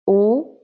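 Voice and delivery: U as "oo", rising; second tone. Shown as a demonstration. Teacher drilling u line, rising. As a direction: slide U ("oo") upward. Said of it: "And then u".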